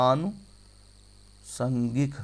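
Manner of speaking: आनुसांगिक very slowly, long gap after आनु; syllable by syllable. Shown as a demonstration. Teacher dictating for students to remember, syllable by syllable. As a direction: draw out आनु, pause, then सांगिक. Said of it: "ऐसे होता है".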